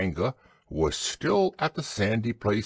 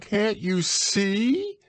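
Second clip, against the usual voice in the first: high squeaky shout; shouting Piglet; 13 semitones higher, much slower than usual. A narrator's reading. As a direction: squeal it out in frustration. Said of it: "shouted Piglet".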